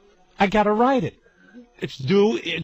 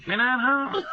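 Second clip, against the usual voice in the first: mock mother; 8 semitones higher, 4 dB quieter, slower than usual. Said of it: "She's just talking to my phone".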